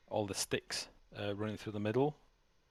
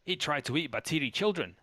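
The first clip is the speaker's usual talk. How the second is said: higher-pitched goblin voice in broken speech; goblin guard; 6 semitones higher than usual. Acted. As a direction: accuse like an indignant little goblin. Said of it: "I'm gonna turn the goblin".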